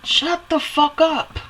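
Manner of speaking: deliberate, slowed shut-up pitched high; rare harsh outburst. Real, not acted. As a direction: spit it out slowly, fed up. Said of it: "I rarely talk like this".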